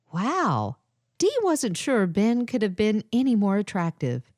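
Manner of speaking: ordinary delivery, nothing marked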